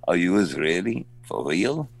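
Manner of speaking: low, soft imitated Israeli accent; doubtful question in an Israeli accent; foreign accent. Stranger doubting the actor is Israeli, Israeli accent. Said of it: "in an accent will say".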